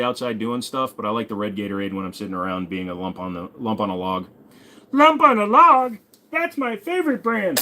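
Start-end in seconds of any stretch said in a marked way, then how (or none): 4.9-6.0 much higher, slow, goofy character voice; silly put-on voice. Same phrase, silly put-on voice. 6.3-7.6 silly put-on voice. Joke continues in put-on voice.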